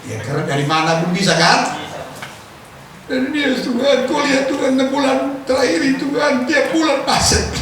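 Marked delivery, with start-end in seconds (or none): 3.1-7.5 higher, whining complaint to God; mock lament. Preacher voicing a worshipper complaining to God, pleading. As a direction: plead about the bills.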